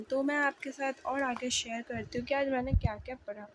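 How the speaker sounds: normal talking voice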